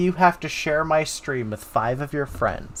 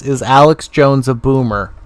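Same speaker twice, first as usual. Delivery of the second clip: louder repeat; 9 dB louder than usual. Real, not acted.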